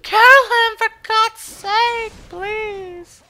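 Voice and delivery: very high, drawn-out loud wailing; desperate screaming. Real, not acted. Gamer begging for a kill, desperate screaming. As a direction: scream and plead, stretching the words.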